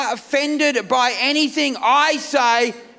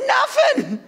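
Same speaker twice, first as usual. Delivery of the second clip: much higher, shrill-free squeak of denial; squeaky denial; 13 semitones higher than usual. Retold or imitated. Husband denying he's sick to his wife, in a high squeak. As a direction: squeak it out like a guilty man. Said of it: "I said".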